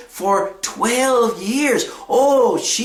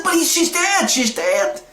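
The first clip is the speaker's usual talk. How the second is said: mildly indignant acted objection, pitched up then falling; angry protest; 7 semitones higher than usual. Mourners objecting to Jesus at the house, acted mild anger. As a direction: protest it as an annoyed mourner would. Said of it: "were quite angry and said".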